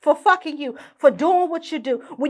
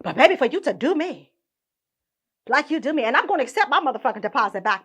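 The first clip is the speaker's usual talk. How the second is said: ordinary talk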